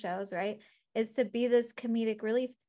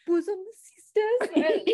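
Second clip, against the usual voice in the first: slow, high mock-English accent; fake posh English accent; 9 semitones higher, 7 dB louder, much slower than usual. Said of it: "faking an accent".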